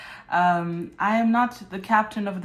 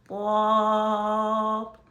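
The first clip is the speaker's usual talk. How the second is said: robot voice; 4 dB louder than usual. Acted. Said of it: "Mister Robot".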